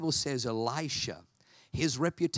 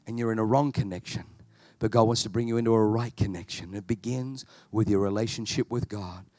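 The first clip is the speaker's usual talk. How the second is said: lower, earnest altar-call prayer voice; closing prayer; 3 semitones lower, 4 dB louder than usual. Acted. Preacher praying over the congregation, earnest low prayer voice. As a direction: pray over the room with conviction.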